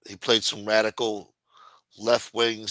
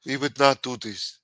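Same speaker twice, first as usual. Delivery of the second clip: imitated South African president's denial; mock South African politician, firmly denying; South African accent; 3 semitones higher than usual. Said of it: "And the president w-, of South Africa was denying it".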